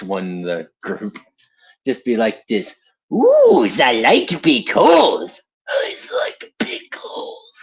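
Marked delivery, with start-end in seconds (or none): 3.1-5.4 put-on much higher, louder German accent; a gleeful mad German doctor; Richtofen. Voicing Richtofen's silly line, loud German accent. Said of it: "a Nazi doctor named Doctor Edward Richtofen". 5.7-7.5 high, slow unvoiced German-accented whisper; Richtofen whisper. Repeating Richtofen's line as a creepy German-accented whisper. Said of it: "a Nazi doctor named Doctor Edward Richtofen".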